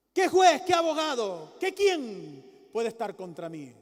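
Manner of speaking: loud, rising sermon delivery; fiery preaching. Acted. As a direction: preach it out to the whole church. Preaching at full force.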